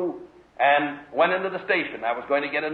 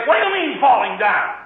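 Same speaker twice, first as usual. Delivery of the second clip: higher, louder mock scolding; hammed-up stern parent; 8 semitones higher, 4 dB louder than usual. Parent mock-scolding toddler who fell, higher hammed-up scold. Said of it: "Do you go and say".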